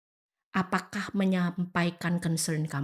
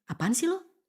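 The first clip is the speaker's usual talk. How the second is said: dismissive retort; 9 semitones higher than usual. Retold or imitated. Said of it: "Mungkin kalau laki-laki di sini".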